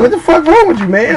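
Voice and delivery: high-pitched comic protest; mock indignant disbelief. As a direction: push him off with a squealed objection. Retold objection to the dancer.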